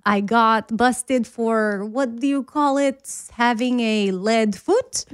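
slow mock Russian confession; Russian accent. Re-enacting a taped confession to dad, mock Russian accent. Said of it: "I was Russian".

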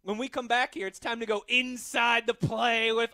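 high, loud announcer build; big drawn-out show tease; radio segment tease. Acted. Host sending listeners to the break, punchy announcer tease.

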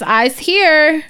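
put-on high-pitched little-kid announcement; announcing toddler. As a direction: announce yourself proudly like a small child. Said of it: "she announces".

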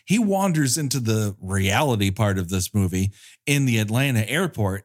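usual speaking voice

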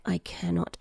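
low, very soft murmur; hushed, secretive quote; retold whisper. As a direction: imitate a quiet word in someone's ear. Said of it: "like, in a whisper".

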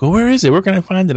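eager friend. Retold or imitated. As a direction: ask it eagerly. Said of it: "and they're like".

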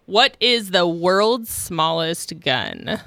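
ordinary delivery

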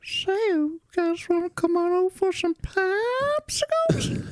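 put-on creepy old man, high whistly whisper; slow, high, airy creep luring someone; quavery whispered falsetto, drawn out; Herbert the Pervert. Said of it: "Like Herbert the Pervert from Family Guy".

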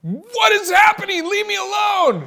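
high, loud hammed-up yell; retold outburst, yelled to be left alone; exasperated shout. Husband in the bathroom yelling back at wife, mock exasperated shout.

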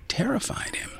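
usual speaking voice

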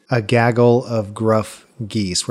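slow, each hard G sound hit; alliteration demo. Shown as a demonstration. Host showing listeners alliteration, slow with hard Gs. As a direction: lean on every G, slowly. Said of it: "where those hard G sounds begin the words".

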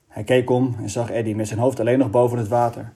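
usual speaking voice

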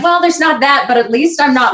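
upbeat consolation. Acted. Audience quote, making the best of it.

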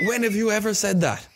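high, loud disbelieving challenge; incredulous outburst. Real, not acted. Ranting at friends about fake accents, loud and incredulous. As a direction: fling the question up, outraged.

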